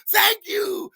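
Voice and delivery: high, loud, thrilled "Thank you"; a grateful partygoer's happy shriek; excited thanks. Retold or imitated. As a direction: squeal it like a delighted guest. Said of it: "And she said".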